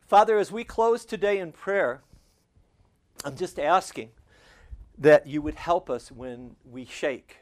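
a rising closing prayer; fervent prayer. Acted. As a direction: pray over the congregation with fervour.

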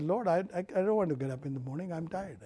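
soft, quick, weary plea to the Lord; retold tired prayer. Pastor retelling his late-night prayer, soft and weary. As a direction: murmur it like a bedtime sigh. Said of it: "and I said".

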